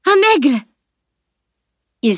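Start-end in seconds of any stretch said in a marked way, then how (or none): 0.0-0.6 much higher, delighted exclamation; sudden cry. A narrator's reading. Siam spots an eagle from the lookout, crying out. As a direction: point it out with an excited cry. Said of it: "s'écria-t-elle soudain".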